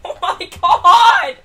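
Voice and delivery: slow, loud, laughing in disbelief; exasperated laugh. Real, not acted. Streamer laughing at his own bad luck, exasperated laugh. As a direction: laugh it out because you can't believe it.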